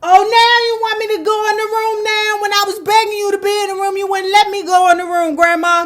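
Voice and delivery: put-on high, put-upon child protesting; a kid throwing her grandma's words back at her; indignant kid. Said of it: "My grandmother said".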